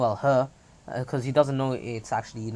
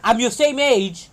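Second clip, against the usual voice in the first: high, loud offended outburst; indignant protest; 10 semitones higher, 6 dB louder than usual. Acted. Mei objects to being called older, high indignant shout. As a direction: insist you're the same age, offended.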